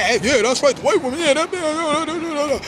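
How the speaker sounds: mock rabble cheering it on; eager agreement. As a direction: pile on the yeahs, babbling along. Acting out people eagerly agreeing.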